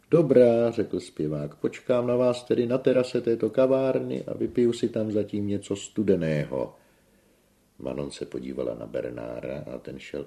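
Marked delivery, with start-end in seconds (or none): 0.1-0.7 high, booming assent; big stage-voice approval; theatrical tenor. A narrator's reading. Tenor agrees with a flourish. 1.5-6.7 high, loud sustained announcement; grand opera-star delivery; theatrical tenor. A narrator's reading. Tenor grandly announces he will wait.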